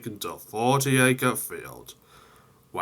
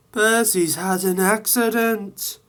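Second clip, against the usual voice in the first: higher, louder urgent report; Toby's news; 11 semitones higher, 3 dB louder than usual. Acted. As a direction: burst in with the news. Toby brings news.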